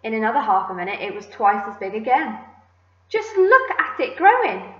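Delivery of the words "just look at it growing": high, loud exclamation; excited cry. A narrator's reading. Aunt watching the giant peach grow, excited cry. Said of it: "Aunt Spiker cried".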